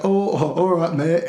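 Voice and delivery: like a deer in the headlights; imitated startled concern. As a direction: act caught off guard, placating. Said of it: "like deer in the headlights".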